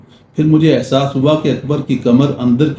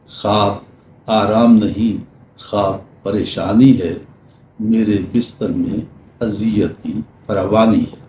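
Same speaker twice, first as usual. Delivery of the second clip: poem recital; 3 semitones lower than usual. Acted. Recites a couplet.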